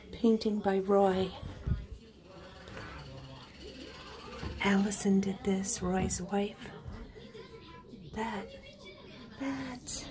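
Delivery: low, soft murmur; hushed. Real, not acted. Owner quietly showing keepsakes before the birds hear, hushed. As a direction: speak softly and low, unhurried.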